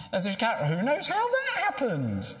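high, slow, wide-eyed innocence; mock innocent excuse. Preacher mimicking Aaron's excuse to Moses, mock innocent. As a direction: play dumb, who could have known. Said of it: "you get that comedy line".

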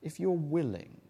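"If you're willing" said softly, almost held back; humble quiet ask. Shown as a demonstration. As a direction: ask it humbly, under the breath. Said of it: "notice, will you, how he asks this".

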